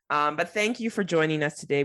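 ordinary talk

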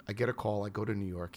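usual speaking voice

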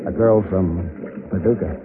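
soft, low tender confession. Acted.

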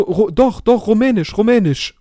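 mock drunk insisting; hint of a drunk stranger insisting; insistent drunk man. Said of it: "Und er so, er so".

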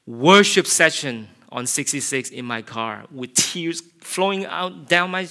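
ordinary talk

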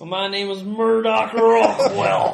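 slow, loud, manly drawl; a hint of a macho tough guy. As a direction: puff up and sound manly. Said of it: "It suddenly became more manly".

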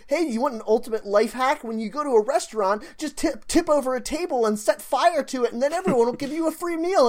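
high, fast mock tipster voice; chirpy life-hack pitch. Podcaster mimicking people who share life hacks, mock chirpy pitch.

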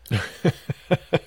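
real laugh, cracking up; helpless laughter. Real, not acted. Podcaster recalling a funny documentary to his co-host, cracking up. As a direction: burst into high, helpless laughter.

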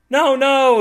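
loud high panicked no; screaming agents. A narrator's reading. FBI agents screaming to stop her, loud panic. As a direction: yell it from the van. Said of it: "the agents in the van scream".

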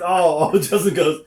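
normal talking voice

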